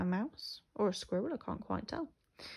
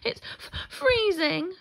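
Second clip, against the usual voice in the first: shivering; 10 semitones higher, 8 dB louder than usual. A narrator's reading. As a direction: stammer it as a freezing little rabbit. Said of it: "two shivering rabbits".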